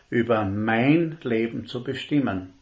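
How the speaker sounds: "mein" leaned on, held and falling; stress on mein. Shown as a demonstration. Preacher to congregation stressing "mein", held and falling. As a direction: hit the word "mein" and let it fall. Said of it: "Die Betonung liegt auf mein".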